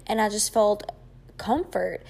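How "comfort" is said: ordinary talk, nothing marked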